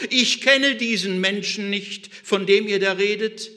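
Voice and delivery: loud, high, vehement denial; cursing and insisting at full volume; swearing denial. Acted. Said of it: "Er verfluchte sich selbst und schwor".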